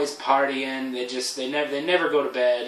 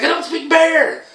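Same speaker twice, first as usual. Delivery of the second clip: much higher, slow, cocky retort; mock defiance; 8 dB louder than usual. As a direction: fire it back, high and drawn out. Defiant reply again.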